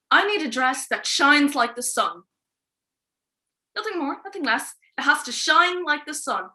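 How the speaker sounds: a spoiled princess laying down terms; demanding princess. Acted. Princess to the king, loud and insistent.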